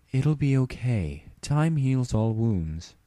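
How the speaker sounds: slow and comforting; reassuring. Acted. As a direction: soothe your grieving sister gently. Celestia comforting Luna.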